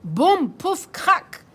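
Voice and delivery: loud punchy sound words; crash effect. Acted. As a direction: bang out the crash. (boum pouf crac lancés fort)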